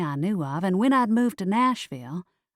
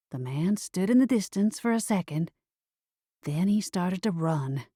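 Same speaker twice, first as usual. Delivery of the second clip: ordinary delivery, nothing marked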